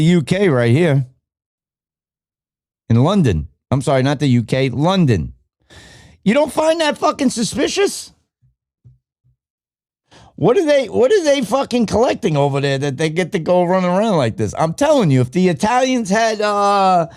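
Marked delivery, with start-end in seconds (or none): 6.2-14.5 higher, disbelieving challenge; can you believe this, pushed up high; incredulous. Real, not acted. Demanding viewers see it's suspicious, incredulous.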